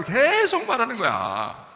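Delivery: 계속 stretched long, high and loud; exasperated stress on 계속. Acted. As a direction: drag out 계속 to show endless nagging. Mimicking refusal to stop nagging. ('계속'을 높고 길게 늘여 강조)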